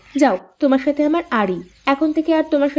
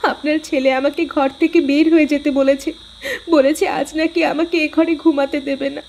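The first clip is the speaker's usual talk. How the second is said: ordinary talk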